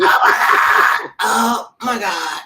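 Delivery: drawn-out, loud fan shriek; starstruck outburst. Retold or imitated. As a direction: re-enact being starstruck, stretching every word. Retold starstruck reaction to meeting idol.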